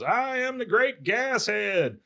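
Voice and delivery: high, drawn-out mock proclamation; Bobby. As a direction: proclaim a grand stage title, slowly. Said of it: "and goes".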